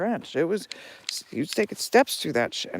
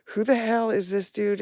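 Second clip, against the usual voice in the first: mock anger; 7 semitones higher, 5 dB louder than usual. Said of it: "I got mad".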